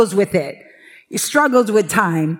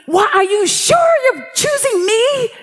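incredulous shout; 10 semitones higher than usual. Retold or imitated. Preacher retelling her disbelieving protest to God, shouting. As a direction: shout it up in protest, asking why me. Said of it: "I was giving every possible excuse".

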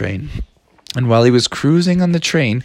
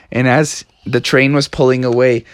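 usual speaking voice

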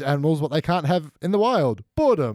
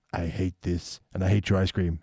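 put-on low, sulky kid grumble; resentful grumble; 6 semitones lower, 4 dB quieter than usual. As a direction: mutter it like a sulking boy. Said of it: "you can see him going".

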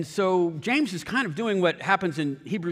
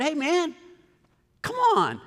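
confronting the thief outside Walgreens, pitched up and yelling; retold angry yell; 12 semitones higher than usual. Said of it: "those words that I yelled at that guy".

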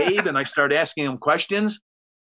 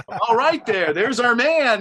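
higher, louder hammed-up host sign-off; mock cheery sign-off; 8 semitones higher, 3 dB louder, slower than usual. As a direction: wrap up with forced cheer. Host clapping guest off.

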